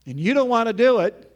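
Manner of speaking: ordinary talk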